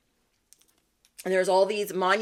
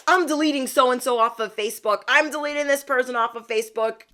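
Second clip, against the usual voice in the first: angry father-in-law; 5 semitones higher, 4 dB louder than usual. Retold or imitated. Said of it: "was mad".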